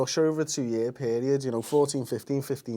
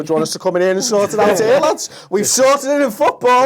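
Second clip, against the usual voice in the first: hammed-up FIFA official; 7 semitones higher, 6 dB louder than usual. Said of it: "phoning up America and go".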